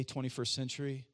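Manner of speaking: ordinary delivery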